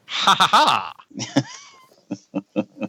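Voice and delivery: very slow, drawn out villain laugh; a hammy melodrama cackle; mustache twirling villain. Acted. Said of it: "twirling the mustache ... laugh".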